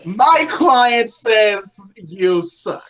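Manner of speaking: very slow, grandiose manager announcement; Paul Heyman. Retold or imitated. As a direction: draw out every word like a mouthpiece. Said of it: "have a little Paul Heyman come out and say that".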